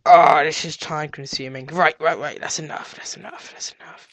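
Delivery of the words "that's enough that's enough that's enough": hushed, breath-only repeats; fading to whisper. Pretend. Player cutting himself short, fading whisper. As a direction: whisper it, softer each time.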